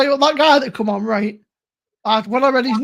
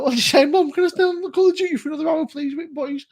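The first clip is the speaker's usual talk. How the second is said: put-on higher, fast, pleading child voice; mock kid begging mum; 6 semitones higher than usual. Mocking a friend as a kid pleading with mum, high and fast. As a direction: whine like a kid begging to stay online. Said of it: "I was like".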